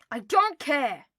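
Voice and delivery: loud, high yell; yell back. Acted. Harry yelling back at Draco while running. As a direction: fling it back defiantly. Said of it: "Harry yelled back".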